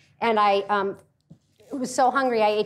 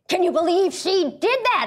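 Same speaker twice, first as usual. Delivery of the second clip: quoting Melissa; 6 semitones higher than usual. Retold or imitated. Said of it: "Melissa turned to me and said".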